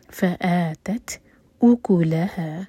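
voiced, very slow tajweed recitation; slow Quran recitation. Shown as a demonstration. Teacher recites the verse to show the rules, slowly. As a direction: draw each syllable out carefully. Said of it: "تا پر ہمز کی جائے گی".

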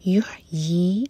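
یحیی slow, ending on a clear ya; ya sound. Shown as a demonstration. Teacher correcting the ending of یحیی, slow. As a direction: draw out یحیی with ya not ee. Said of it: "یا کا sound آئے گا".

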